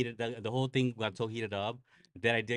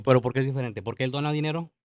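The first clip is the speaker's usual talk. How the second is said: louder, pointed questioning; retold indignant challenge; 4 semitones higher, 9 dB louder, faster than usual. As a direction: throw the double standard back at him. Retold argument challenging church man.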